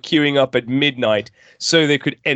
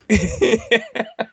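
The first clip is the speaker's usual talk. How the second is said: laugh; 7 semitones higher than usual. Real, not acted. Laughs mid-story.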